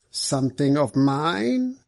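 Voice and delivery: slow, drawn-out question; a little too slowly. A narrator's reading. Suspect questioned by the superintendent about his property, a little too slowly. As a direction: draw the question out unnaturally slowly. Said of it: "perhaps a little more slowly than was quite natural".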